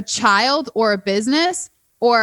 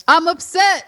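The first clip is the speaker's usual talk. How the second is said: higher, louder mopey singer voice, half-laughing; 7 semitones higher, 4 dB louder than usual. Retold or imitated. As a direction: moan the words like a sulky crooner, giggling. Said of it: "Now I got [name] in my ear".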